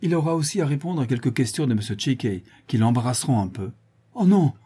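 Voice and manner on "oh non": high, louder cry of protest. A narrator's reading. Victim protesting to the lawyer, crying out. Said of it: "cria la victime".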